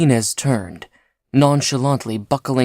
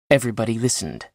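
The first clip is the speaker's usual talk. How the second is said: usual speaking voice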